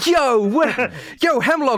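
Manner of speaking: loud, high, rowdy tavern greeting; excited party bro. Acted. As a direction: yell it like a thrilled drunk buddy. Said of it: "Blake goes".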